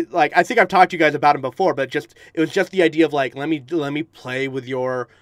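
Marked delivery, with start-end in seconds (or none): none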